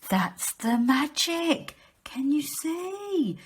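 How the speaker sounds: gasp, then a slow, high, hushed whisper; amazed gasp. Acted. Teacher whispering in wonder to children about the magic. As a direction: marvel at it like a magic trick.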